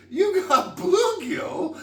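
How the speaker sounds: laughing, high-pitched disbelief, drawn out slowly; amazed delight. Retold or imitated. Telling friends about the bluegill, laughing high and slow. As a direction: squeal it like the best news ever. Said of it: "excited retelling, laughing".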